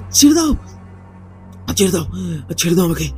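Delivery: plain reading voice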